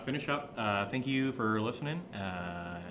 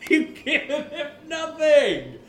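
higher, louder, words through laughter; laughing while mocking; 13 semitones higher, 7 dB louder than usual. Real, not acted. [name] mocking Nate's Patreon rewards through laughter. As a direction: tease him while still laughing.

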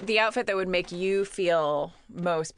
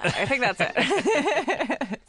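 slow, laughing through words; 8 semitones higher, much slower than usual. Real, not acted. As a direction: crack up while finishing. Breaks character laughing.

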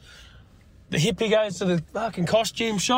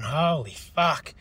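drooling awe; 3 semitones lower, slower than usual. Retold or imitated. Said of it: "just drooling and just going".

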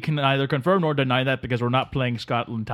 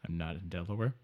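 much lower and much softer, bewildered; baffled muttering guest; Delaware Dave; 7 semitones lower, 13 dB quieter than usual. Acted. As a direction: stammer it out, utterly lost. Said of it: "Delaware Dave confused".

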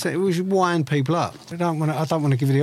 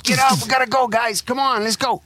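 put-on rally the squad in an American accent; mock American playground accent; 10 semitones higher than usual. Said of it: "American accent, man".

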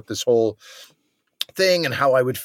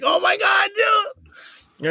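high, shocked "oh my God, dude"; mock-shocked friend exclaiming; shocked cry; much slower than usual. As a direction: cry out at how much butter is gone. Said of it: "R J is like".

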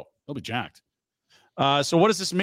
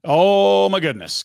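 excited exclamation; 4 semitones higher, 8 dB louder, slower than usual. Real, not acted. Big reaction to news.

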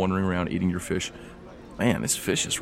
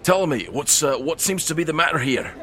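raised, performed dwarf voice; higher-pitched dwarf addressing a stranger; armored dwarf; 9 semitones higher, 3 dB louder than usual. Acted. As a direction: step up and question like a dwarf. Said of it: "a dwarf clad in heavy armor".

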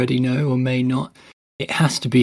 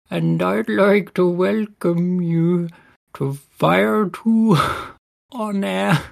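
higher, slow bleating Welsh lilt; a goat from Wales welcoming listeners, bleating; 6 semitones higher, slower than usual. Pretend. Host introduces the podcast as a Welsh goat, high bleating Welsh voice. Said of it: "a Welsh goat is gonna be".

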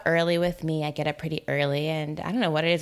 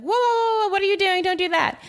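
startled yell; 13 semitones higher, 4 dB louder than usual. Retold or imitated. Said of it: "I don't like it when you yell at me".

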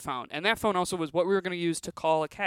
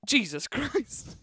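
drawn-out, higher groan of a fed-up dad; exasperated dad; 7 semitones higher, much slower than usual. Retold or imitated. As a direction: sigh it out slowly like a tired father. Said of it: "I hear my dad just go".

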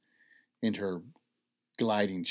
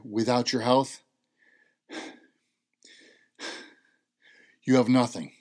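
louder, weighty statement; emphatic; 3 semitones higher, 7 dB louder than usual. Retold or imitated. Mother telling her son the key lesson, louder. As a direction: land the line hard and louder.

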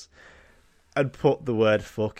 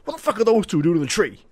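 high, fast shout again; coach shouting; 8 semitones higher, 4 dB louder, faster than usual. Acted. Coach yelling at two in a tree, high fast shout. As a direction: yell it like the coach. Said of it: "He, like, skips down".

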